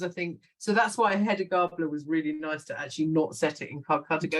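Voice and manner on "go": usual speaking voice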